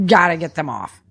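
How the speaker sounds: normal talking voice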